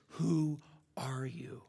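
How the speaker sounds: slow, half-whispered question; hushed question. Retold or imitated. Boy asks the lion who he is, hushed and slow. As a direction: ask it slowly, in a hush.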